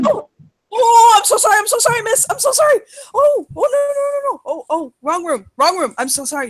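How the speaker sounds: high, frantic string of sorrys; panicked stumbling out of the wrong room; embarrassed, high-pitched blurting; flustered apology. Acted.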